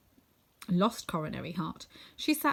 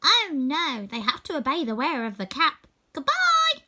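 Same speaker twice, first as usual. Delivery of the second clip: much higher and louder, bright farewell squeak; tiny queen reassuring, then dashing off; squeaky mouse queen; 7 semitones higher, 7 dB louder than usual. Acted. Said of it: "her squeaky little voice".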